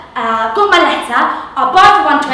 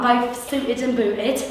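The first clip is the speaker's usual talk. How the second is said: ordinary delivery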